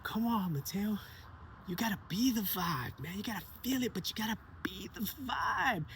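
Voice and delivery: put-on high, encouraging kid talking a friend round; a boy coaxing his shy friend to feel the vibe, high and encouraging. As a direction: lift the pitch and coax gently. Sebastian coaxing Mateo.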